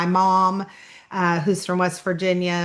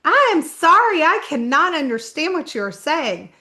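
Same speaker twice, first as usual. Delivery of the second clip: higher, louder opening on a retold apology; drive-through girl; 6 semitones higher, 4 dB louder than usual. Drive-through worker to a fast-talking customer, retold exasperation. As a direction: imitate a puzzled order-taker, pitched up. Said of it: "girl that was running the drive-through said".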